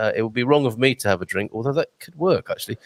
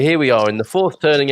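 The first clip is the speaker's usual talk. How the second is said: ordinary talk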